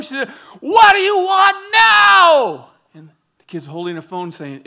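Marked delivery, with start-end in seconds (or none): 0.6-2.7 high, loud, slow yell, each word drawn out; furious shout. Retold or imitated. Mom throwing the door open on her kids, a furious shout. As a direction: blow up at the kids.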